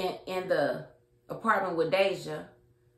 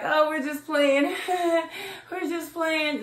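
laughing it off; 9 semitones higher, 4 dB louder than usual. Retold or imitated. Bryce laughs off the kiss.

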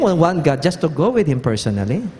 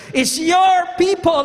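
pleading shout; 10 semitones higher than usual. Acted. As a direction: cry out to the Lord, pitched high. Preacher voices Moses pleading loudly.

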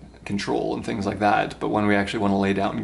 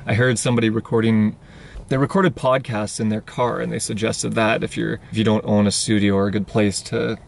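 usual speaking voice

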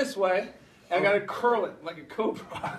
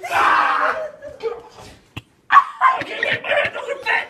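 much higher laughing yells; laughing yelps; 13 semitones higher, 4 dB louder than usual. Real, not acted. Guy stuck in a failed flip, laughing yells. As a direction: yelp and laugh while stuck. Stuck mid backflip attempt.

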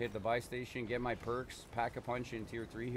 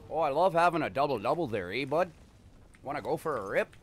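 louder, hammed-up Canadian accent; put-on Canadian accent; 3 semitones higher, 5 dB louder than usual. Gamer showing Americans how Canadians talk, hammed-up Canadian accent. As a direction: lay the Canadian lilt on thick. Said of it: "The Canadian accent".

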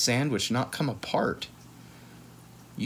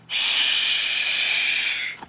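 loud shush; 9 dB louder than usual. Acted. Librarian hushing.

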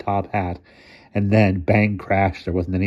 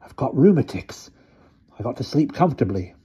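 put-on high, desperate old-man plea; desperate pleading; 6 semitones higher than usual. A narrator's reading. Mister Thomas begs the boy to free him, desperate. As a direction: plead to be let out, voice leaping up. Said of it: "Mister Thomas pleaded desperately".

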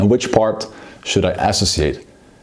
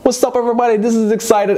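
hammed-up, high-pitched hype greeting; over-associated excitement; 13 semitones higher, faster than usual. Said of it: "If you are too associated".

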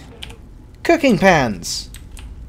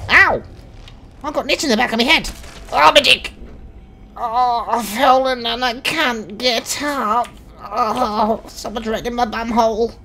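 higher, whining complaint; whiny higher character voice; 6 semitones higher than usual. Acted. Fallen NPC complaining about his injuries, higher whine. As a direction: moan like a hurt little man.

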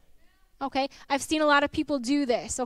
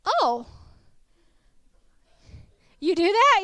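surprised; 5 semitones higher, 5 dB louder than usual. Retold or imitated. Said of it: "And I was like".

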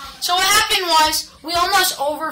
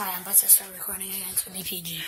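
quick hushed aside; 9 semitones lower, 18 dB quieter, much faster than usual. Real, not acted. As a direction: talk low and fast. Quiet aside before recording.

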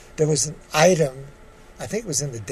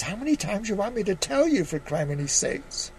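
higher, fast, exasperated; held-back anger; 5 semitones higher than usual. Acted. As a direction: hold down your temper. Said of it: "keep the anger out of my voice".

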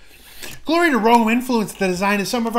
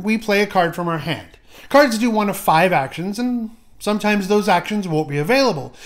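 normal talking voice

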